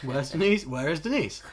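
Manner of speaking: loud hammed-up confusion; mock baffled. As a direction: call out for Denise in mock alarm. Retelling own confusion at Denise missing.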